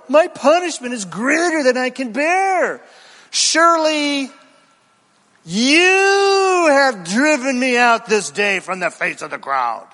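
high, loud crying complaint as Cain; whiny lament. Acted. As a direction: wail it out like a man sorry for himself. Preacher acts Cain's complaint.

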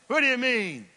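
usual speaking voice

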